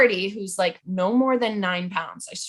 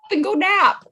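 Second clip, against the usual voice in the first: higher, louder scolding of the pets; mock yelling at cats; 11 semitones higher, 5 dB louder than usual. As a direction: playfully yell at the cat monsters. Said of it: "I yell at them all the time".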